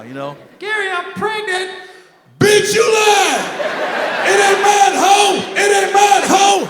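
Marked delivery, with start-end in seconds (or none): 0.6-1.7 high put-on female phone voice; girl caller. Girl phoning him with pregnancy news, high mock-female voice. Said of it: "Let a girl try to call me". 2.4-6.7 high, drawn-out furious shout; cussing her out. Acted. Man denying paternity to a caller, cussing her out at full shout. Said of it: "I've never raised my voice to a woman, but I will".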